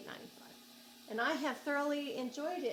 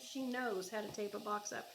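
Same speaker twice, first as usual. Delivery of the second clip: ordinary talk, nothing marked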